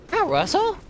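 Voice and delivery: high sweet pet greeting. Real, not acted. Streamer greeting his pet Russell, high cooing. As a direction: coo hello way up high.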